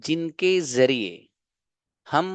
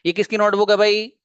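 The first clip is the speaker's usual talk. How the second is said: higher and louder, calling out a question; loud mock question; 8 semitones higher, 7 dB louder than usual. Teacher playing a student asking classmates, loudly. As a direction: call across the room asking whose notebook.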